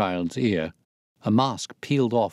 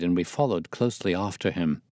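ordinary delivery

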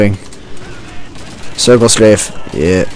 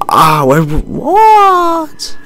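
startled what; much slower than usual. Real, not acted. Sudden surprise mid-fight.

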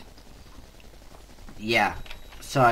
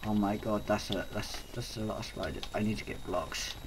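quiet; 9 semitones lower, 12 dB quieter than usual. Real, not acted. Many spiders, quiet dread.

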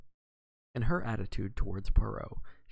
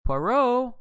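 much higher, louder shout of the name; calling out; 13 semitones higher, 8 dB louder than usual. A narrator's reading. Narrator calls for the missing detective, loud. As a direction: call out to find him in an empty room. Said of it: "I cried".

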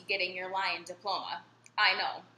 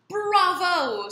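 cheering bravo; 10 semitones higher, 10 dB louder than usual. A narrator's reading. Lion cheers pupil's roar.

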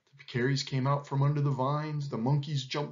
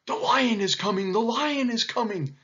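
higher, urgent warning cry; alarmed cry; 9 semitones higher than usual. A narrator's reading. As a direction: sound the alarm to everyone. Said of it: "they cried".

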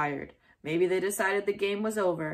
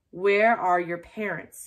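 slow, louder gruff demand; 6 dB louder than usual. A narrator's reading. Angry neighbor at the door, slow demand. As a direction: demand it slowly, looming. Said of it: "he demanded".

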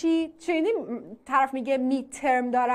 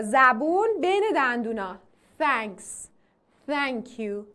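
normal talking voice

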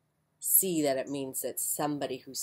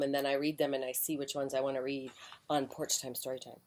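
usual speaking voice

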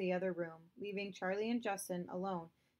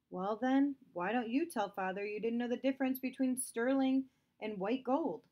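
louder, higher defiant challenge; challenging; 5 semitones higher, 5 dB louder than usual. Acted. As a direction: throw the blame back at her. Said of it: "Justin challenged".

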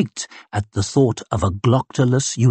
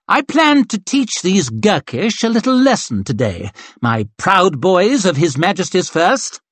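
lightly voiced haughty officer, a touch higher; breezy command from a vain colonel; arrogant colonel; 8 semitones higher than usual. Colonel dismissing his young corporal, lightly haughty.

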